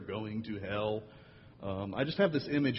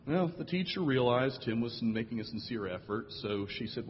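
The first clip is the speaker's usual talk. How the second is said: usual speaking voice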